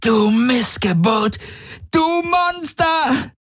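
very high slow shrieked insults. Acted. Prisoner shrieks insults at captor, very high and slow. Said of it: "kreischte sie".

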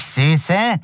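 high, hissed seductive whisper of a voice; hissing. A narrator's reading. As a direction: hiss the word sweetly like a serpent. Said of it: "zischte es".

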